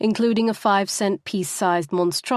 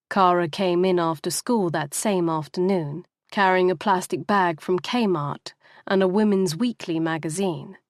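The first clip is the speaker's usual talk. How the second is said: plain reading voice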